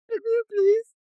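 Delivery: high, squeaky mock kid begging. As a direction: whine it like a cheeky little kid. Year eight kid at the door.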